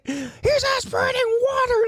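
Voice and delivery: very high mock screech; alarmed old lady. Mocking the old lady's protest, shrieky alarm. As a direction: caricature a panicking old woman. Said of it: "this fucking old lady".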